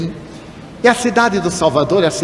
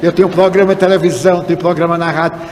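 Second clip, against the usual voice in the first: ordinary delivery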